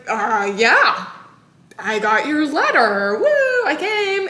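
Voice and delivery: an awkward husband forcing fake joy. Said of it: "And he's like".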